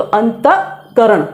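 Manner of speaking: अंतःकरण said slowly with the visarga almost silent; half visarga, slow. Shown as a demonstration. Teacher repeats the word slowly for students. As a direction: drag out अंतःकरण, barely touching the h. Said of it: "ये जो हम बोल रहे हैं".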